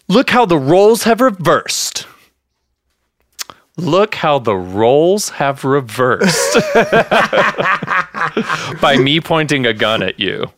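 laughing while quoting Donnie's gun threat; mocking Donnie's odd line. Podcaster quoting Donnie's gun threat, amused mock-menacing voice. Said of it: "why is he talking like that".

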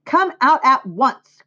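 high, loud, drawn-out order; crying out. A narrator's reading. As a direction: narrator voicing a mother's sharp call. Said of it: "cried Missus Arable".

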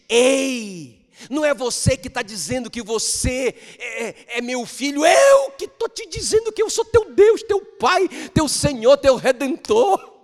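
loud, high shouted proclamation, slowing at the end; God declaring to his child, shouting; God's voice proclaiming. Acted. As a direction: thunder it out as God. Voicing God declaring identity.

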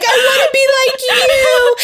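high squealing fangirl voice, slowed; hammed-up adoring squeal; mock swooning fan. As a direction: gush like a starstruck kid. Eamon voiced idolizing Damon.

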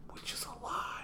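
whispered aside; hushed conspiratorial whisper. Real, not acted. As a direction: whisper it like a secret. Aside revealing lie.

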